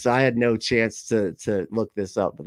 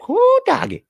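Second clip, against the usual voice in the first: very high, loud mock country accent; Lynn's imagined accent; 7 dB louder than usual. Host guessing how a chat winner talks, hammed-up accent. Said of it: "is that the accent you actually have".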